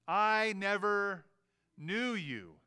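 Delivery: slow, emphatic pronouncement; Jesus in judgment. Acted. Jesus rejecting false followers at judgment, slow weighty pronouncement. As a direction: stretch it out, pausing after never. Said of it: "He'll say".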